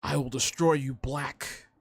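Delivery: lightly voiced alien leader making threats. Guessing what the alien leader will say, mock villain threat. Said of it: "he's gonna say something like".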